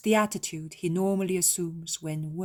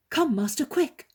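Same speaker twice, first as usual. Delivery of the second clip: rising, louder urgent summons; urgent call; 9 semitones higher, 7 dB louder than usual. A narrator's reading. Servant urgently calling the master to come quickly. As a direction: hurry the master in, voice climbing.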